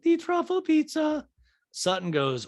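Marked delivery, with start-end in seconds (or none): 0.0-1.3 high, slow put-on voice; Diana. Diana ordering from the server, high mock impression. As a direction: mimic her high and drawn out. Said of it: "And Dinah's like".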